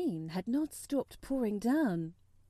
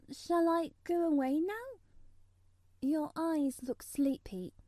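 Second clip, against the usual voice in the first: put-on young girl voice; 7 semitones higher than usual. A narrator's reading. Mary voiced, higher child voice.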